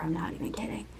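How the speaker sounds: unvoiced whisper tacked on at the end; whispered aside. Real, not acted. Whispering to viewers about her slacking sister. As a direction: drop to a conspiratorial whisper.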